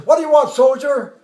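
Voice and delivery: loud officer answering a soldier; colonel's gruff reply. Retold or imitated. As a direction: imitate a commander's brisk question.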